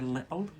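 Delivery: "little" with the t swallowed to a glottal stop; Scottish "little". Shown as a demonstration. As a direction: say "little" as li'le, Scottish style. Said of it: "here in Scotland, to say a little".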